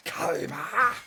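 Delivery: loud, high, outraged cry of the name; Yugi crying Kaiba. Retold or imitated. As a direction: shout the rival's name like Yugi. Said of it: "how Yugi usually retorts when he says Kaiba".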